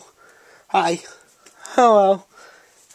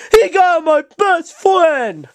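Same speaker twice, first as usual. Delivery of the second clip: outraged cry; 8 semitones higher, slower than usual. Acted. Toy character accuses Politoed.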